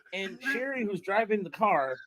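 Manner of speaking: ordinary talk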